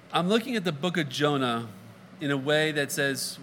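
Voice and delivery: normal talking voice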